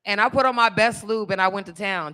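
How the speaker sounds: ordinary talk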